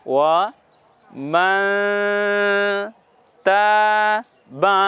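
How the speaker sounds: ওয়া মাং তা বা said syllable by syllable, মাং nasal; slow syllable demo. Shown as a demonstration. Tutor modelling recitation syllable by syllable. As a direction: spell it out slowly with a nasal মাং. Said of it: "নাকের মধ্যে নিয়ে যাচ্ছি".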